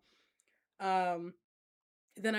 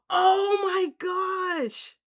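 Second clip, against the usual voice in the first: gasping, high and loud; shocked gasp; 12 semitones higher, 11 dB louder, much slower than usual. Real, not acted. Sudden shocking spoiler news read.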